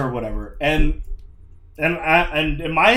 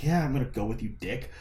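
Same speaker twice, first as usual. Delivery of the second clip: snide aside; 4 semitones lower, 12 dB quieter than usual. Retold or imitated. Snide answer to salesman.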